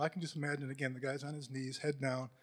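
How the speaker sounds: ordinary talk, nothing marked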